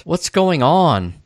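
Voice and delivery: ordinary talk, nothing marked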